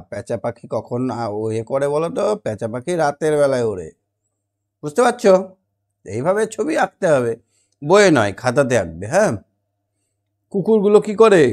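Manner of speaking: usual speaking voice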